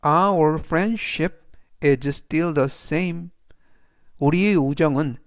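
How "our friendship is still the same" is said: every word spoken slowly and clearly; slow model reading. Shown as a demonstration. Teacher reading the example sentence to students, slow and clear. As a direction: read the sentence slowly for learners. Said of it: "그래서 전체적 의미는".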